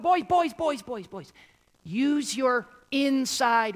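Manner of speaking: put-on high, loud call of boys; hollering over the dinner noise; mom calling the boys. Mom shouting at her five boys at the dinner table. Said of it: "my mom would be like".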